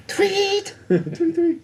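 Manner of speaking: very high-pitched spoken "tweet"; imitated whistle. Game master plays a guard blowing a whistle, high mock tweet. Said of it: "somebody blowing a whistle".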